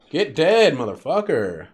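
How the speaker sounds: loud, high gaming taunt; battle shout. Real, not acted. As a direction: shout it at an enemy in triumph. Calls out at game enemy.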